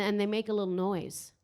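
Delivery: usual speaking voice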